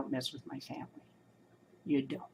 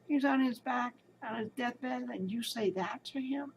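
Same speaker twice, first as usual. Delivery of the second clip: mild mock of her angry reply; retold indignation; 7 semitones higher than usual. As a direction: confront the doctor, retold. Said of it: "I said".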